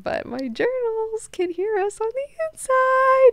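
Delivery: very high, slow, drawn-out squeal; secret gloating. Retold or imitated. Leto thinking what he won't tell Duncan, gloating. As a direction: stretch it out in a silly high voice.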